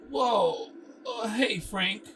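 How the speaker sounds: a high, loud jumpy "whoa"; startled greeting. Acted. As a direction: jump at finding him there. Startled by Frank in dark room.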